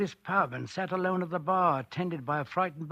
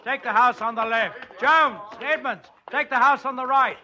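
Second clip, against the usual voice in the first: loud, higher commanding calls over the crowd; barked orders; 5 semitones higher, 6 dB louder than usual. Acted. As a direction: take charge and bark orders to your men. Sergeant orders crowd and constables.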